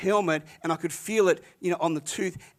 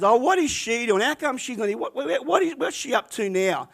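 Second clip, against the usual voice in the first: higher, incredulous mocking townsfolk voice; gossipy mockery; 7 semitones higher, 4 dB louder than usual. Cafe locals gossiping about Peggy, high mocking voice. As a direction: sneer at the absent neighbour from high up. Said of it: "they were just ragging on it".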